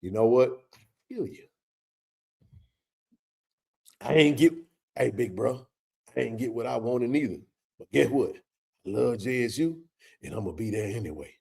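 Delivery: soft, low, consoling voice to a fan; soft reassuring words to a disappointed fan; consoling buddy. Retold or imitated. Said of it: "I put my arm around you and say".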